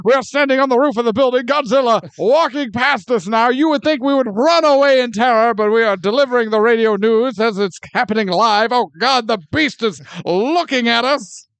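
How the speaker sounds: loud, fast live radio report; 1940s radio announcer. Pretend. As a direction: report live as disaster unfolds.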